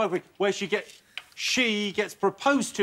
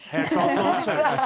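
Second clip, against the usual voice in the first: high, drawn-out amused repeat of the answer; mock incredulous echo; 9 semitones higher, 4 dB louder, much slower than usual. Host reading a panellist's answer back, amused disbelief. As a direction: read it back slowly, laughing in disbelief.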